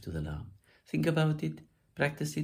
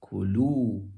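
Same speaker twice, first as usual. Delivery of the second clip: "kulu" with the second syllable lu stressed; "kulu", louder on the lam; 5 dB louder than usual. Shown as a demonstration. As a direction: punch the lu in "kulu". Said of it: "shift to the lam of the verb".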